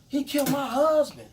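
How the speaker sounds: high, loud accusing shriek; screaming the accusation at police; blurted scream. Retold or imitated. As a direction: blurt it out in a panic. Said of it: "blurt out".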